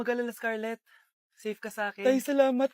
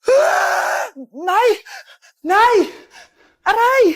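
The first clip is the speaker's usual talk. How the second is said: loud, higher cries of fright and pain; panicked shrieks; terrified screams; 4 semitones higher, 10 dB louder, much slower than usual. Acted. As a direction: scream for mother in terror. Girl screams, attacked in the house.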